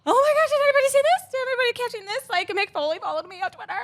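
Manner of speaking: very high, fast fangirl squeal; re-enacted giddy freak-out; starstruck excitement. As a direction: blurt it out to everyone in a giddy rush. Retold starstruck reaction.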